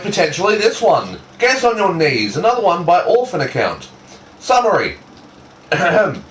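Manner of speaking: usual speaking voice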